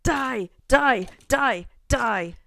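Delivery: loud, high repeated yell; battle yell. Real, not acted. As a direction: yell it with each hit. Fighting a skeleton.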